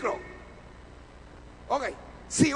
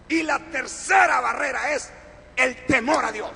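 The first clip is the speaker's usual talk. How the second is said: loud, emphatic sermon delivery; forceful preaching; 6 dB louder than usual. Real, not acted. Preacher teaches the congregation the three barriers, loud and forceful. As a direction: drive each point home to the congregation.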